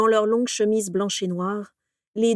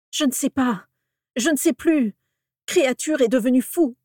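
higher, louder distraught lament; 5 semitones higher, 4 dB louder than usual. A narrator's reading. Orion laments to his nieces about his lost son. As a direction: wail out his helplessness. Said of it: "se lamenta Orion".

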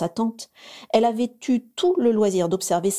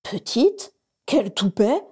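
higher indignant outburst; outraged at being called little; indignée; 3 semitones higher, slower than usual. A narrator's reading. Indignant thought. (indignation soudaine)

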